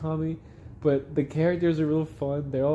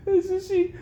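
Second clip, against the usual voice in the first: squeaky high words through laughter; cracking up. Real, not acted. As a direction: struggle to resume through the giggles. Still laughing at the scene.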